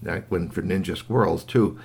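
plain reading voice

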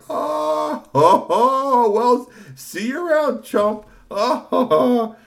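very high, loud mocking squeal; jeering bully voice. Bullies sneering goodbye to Tony, mocking. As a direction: taunt him high and slow.

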